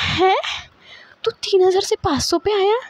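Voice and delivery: high, loud disbelieving retort; incredulous. Retold or imitated. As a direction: challenge him in sheer disbelief. Incredulous at the sudden price drop. (ऊँची आवाज़ में अविश्वास से सवाल)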